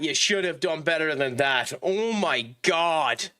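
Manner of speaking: usual speaking voice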